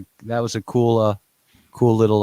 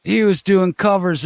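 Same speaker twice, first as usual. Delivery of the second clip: excited; 11 semitones higher, 8 dB louder than usual. Real, not acted. Excited praise of cover art.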